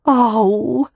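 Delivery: high, loud coaxing call; teasing prostitute. A narrator's reading. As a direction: egg him on, loud and high. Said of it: "one of the prostitutes sighed".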